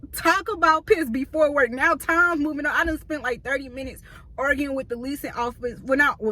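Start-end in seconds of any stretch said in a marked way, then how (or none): 0.1-1.7 annoyed emphasis. Retold or imitated.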